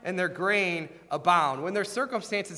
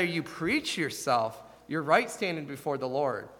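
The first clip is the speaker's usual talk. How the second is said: ordinary delivery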